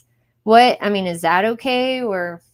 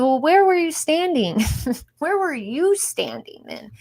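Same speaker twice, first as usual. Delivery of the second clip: incredulous retort; 7 semitones higher than usual. Retold or imitated. She challenges her tall coworker on where he stood, incredulous and laughing. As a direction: needle him with a half-laughing question.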